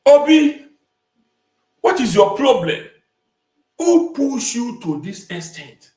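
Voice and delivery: loud, high, exaggerated call-out; loud confrontation. Commentator challenging Obi over his problem, loud mock confrontation. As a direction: call him out with big, stretched questions.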